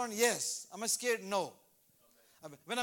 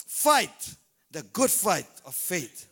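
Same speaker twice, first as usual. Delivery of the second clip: very slow, loud scripture; slow booming verse; slow proclamation; 4 semitones lower, 8 dB louder, much slower than usual. Acted. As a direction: stretch out each word.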